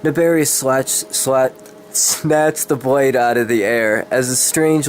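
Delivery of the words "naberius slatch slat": ordinary delivery, nothing marked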